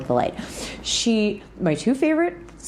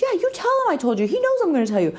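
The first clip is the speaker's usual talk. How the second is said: much higher, fast, eager friend voice; Brent egging her on; 12 semitones higher, much faster than usual. Retold or imitated. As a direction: imitate the friend urging her on, high and quick. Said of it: "He goes".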